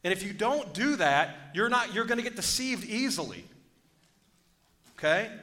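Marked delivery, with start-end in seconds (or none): none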